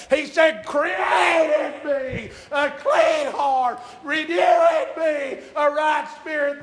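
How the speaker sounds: loud, high-pitched revival sermon delivery; full-force preaching. Acted. Preacher urging the church to praise the Lord, full-force preaching. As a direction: preach it hard, stretching key words out.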